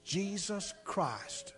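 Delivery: put-on a boy whispering the forbidden words to the preacher; whispered. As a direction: breathe the words into his ear. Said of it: "he whispered in my ear".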